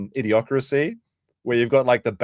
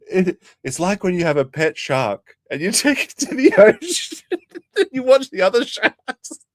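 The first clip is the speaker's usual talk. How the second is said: laughing; 11 semitones higher, 6 dB louder than usual. Real, not acted. Retelling analogy while cracking up.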